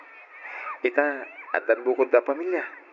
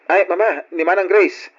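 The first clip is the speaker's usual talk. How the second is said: raised voice calling out to mother; 6 semitones higher, 7 dB louder than usual. Acted. Son calls his mother to take his sister's call, loud and bright.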